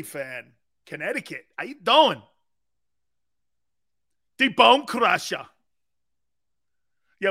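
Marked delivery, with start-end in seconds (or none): 1.6-5.5 put-on loud, raised Austrian-style wrestler accent; put-on wrestling accent. Wrestler recalling his ring name from Austria, in a put-on accent. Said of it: "I don't know why I had an accent".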